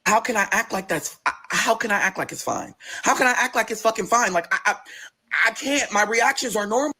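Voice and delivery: high, stammering disbelief; flustered protest. Retold or imitated. Protests being told act normal.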